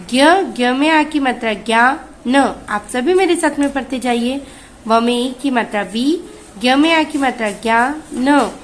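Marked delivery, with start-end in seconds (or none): none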